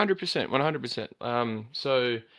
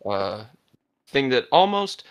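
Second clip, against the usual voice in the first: ordinary delivery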